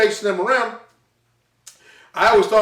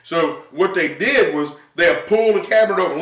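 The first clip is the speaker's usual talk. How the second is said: normal talking voice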